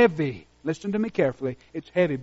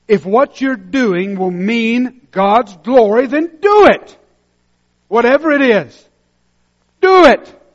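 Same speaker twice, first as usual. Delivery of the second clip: high, loud preacher's cry; preaching shout; 6 semitones higher, 10 dB louder than usual. Real, not acted. Pastor commanding the congregation to act, shouting. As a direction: hammer the command home.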